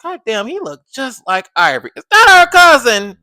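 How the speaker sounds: loud, high, disbelieving exclaim; shocked reaction. Retold or imitated. Reacting to Katari looking like Ivory, loud surprise. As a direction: gasp out the likeness loud. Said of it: "I was like".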